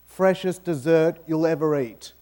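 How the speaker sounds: normal talking voice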